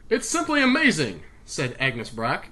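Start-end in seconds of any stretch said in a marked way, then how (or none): 0.1-1.2 higher, louder bad British accent; amazed scientist in a dodgy British accent. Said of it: "accents eventually turn into, like, some really bad Irish thing".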